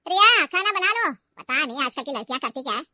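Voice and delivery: mother-in-law sneering loudly at her son's wife; taunting. Acted. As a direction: bark it out with contempt. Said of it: "वो रिया को ताने मारने लगी".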